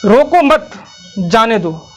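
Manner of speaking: group "रोको मत", pause, then "जाने दो"; pause after मत. Shown as a demonstration. Teacher giving the intended order, pausing after मत. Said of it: "मैं तो कहा था".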